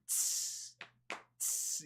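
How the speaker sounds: soft unvoiced tss hiss; Cobra style tss. Showing how he'll hiss at annoying people, cobra style. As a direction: hiss softly with fingers up.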